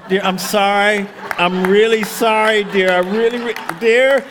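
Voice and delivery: loud, drawn-out begging through a door; hammed-up pleading. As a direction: beg forgiveness at full volume. Begging wife through closed door.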